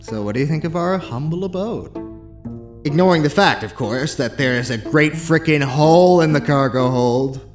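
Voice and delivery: breezy, emphatic joking; flippant. Acted. Bash making light of a hole in the spaceship, flippant. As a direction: make light of it with a punchy finish. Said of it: "flip something as serious as a hole".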